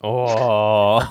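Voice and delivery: drawn-out mocking oh; teasing oh. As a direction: tease him with a long oh. Teasing reaction to hurt friend.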